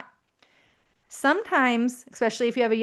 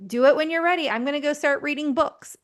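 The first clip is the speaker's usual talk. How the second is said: much higher, breezy and unfussed; breezy unbothered; 4 semitones higher than usual. Shown as a demonstration. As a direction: sound light and unfussed, like it doesn't bother you. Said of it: "then you say".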